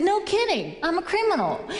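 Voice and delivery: high, punchy comeback; acted-out gleeful confession; retold delighted reply.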